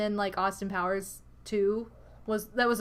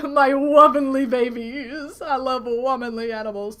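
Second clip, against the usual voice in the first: silly cat-love voice; 9 dB louder than usual. Retold or imitated. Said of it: "make weird voices".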